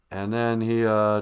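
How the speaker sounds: ordinary delivery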